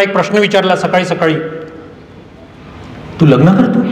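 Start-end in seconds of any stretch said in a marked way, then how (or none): 3.2-3.9 uncle's question. Retold or imitated. Voices the retired uncle.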